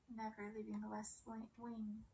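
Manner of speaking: usual speaking voice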